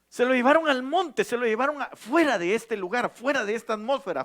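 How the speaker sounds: loud, high, driving sermon voice; full-force preaching. Acted. Pastor stirring the congregation about being taken up, fervent preaching. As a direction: preach it to the rafters.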